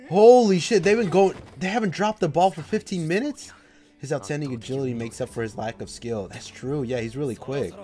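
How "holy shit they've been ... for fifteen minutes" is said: loud, high disbelief; amazed outburst. Real, not acted. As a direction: exclaim in stunned disbelief. Amazed at fifteen-minute rally.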